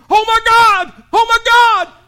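high, loud terrified scream; panicked scream. Retold or imitated. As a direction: scream like the world just ended. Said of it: "I heard him scream".

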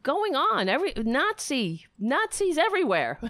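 high-pitched, incredulous outburst; retold disbelief. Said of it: "and I was like".

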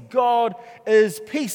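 God is peace, slowed right down and loud; slow emphatic declaration. Acted. Pastor declaring to the church, slow and loud. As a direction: lean hard on every word, slowly.

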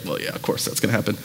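low, soft, quick aside; mock dismissive. Listener shrugging off the pastor's story, mock dismissive. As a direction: toss it off as an unimpressed listener.

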